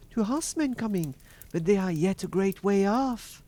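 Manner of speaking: high-pitched voice of the watching sister; Sister Anne. A narrator's reading. Sister Anne reporting from the tower, high-voiced reply. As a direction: answer from above in a high voice.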